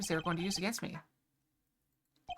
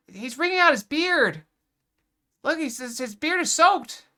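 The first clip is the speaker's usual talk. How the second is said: much higher, louder, slow exclaim; amazed; 7 semitones higher, 8 dB louder, slower than usual. Real, not acted. Player reacting to the weeping chairman, loud and slow. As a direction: cry it out in disbelief, stretched.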